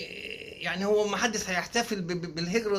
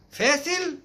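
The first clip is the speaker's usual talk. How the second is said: mock surprised echo; 9 semitones higher, 4 dB louder, much slower than usual.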